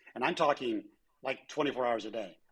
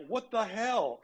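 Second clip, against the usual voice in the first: incredulous customers; 9 semitones higher, slower than usual. Retold or imitated. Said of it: "Customers are like".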